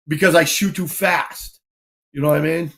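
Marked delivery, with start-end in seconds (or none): none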